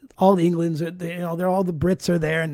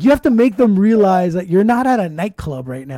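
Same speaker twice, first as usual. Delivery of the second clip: ordinary talk, nothing marked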